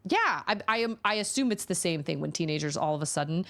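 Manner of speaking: ordinary delivery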